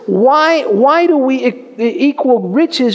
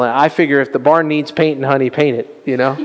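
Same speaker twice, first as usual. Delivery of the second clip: ordinary talk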